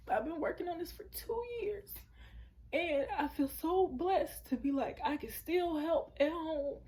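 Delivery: high, teary voice with a few broken laughs; tearful. Real, not acted. Thanking God for the blessing to viewers, real tears. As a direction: voice breaking with gratitude. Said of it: "so I'm trying not to cry".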